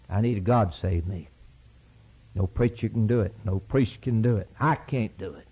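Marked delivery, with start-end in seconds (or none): none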